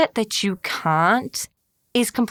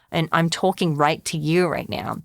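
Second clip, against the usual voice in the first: ordinary delivery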